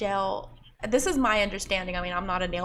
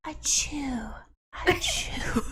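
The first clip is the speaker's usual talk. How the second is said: fake sneeze; 4 semitones higher, much slower than usual. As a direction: pretend to sneeze into the mic. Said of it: "You should have sneezed in the mic".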